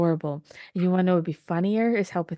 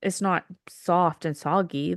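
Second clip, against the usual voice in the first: ordinary talk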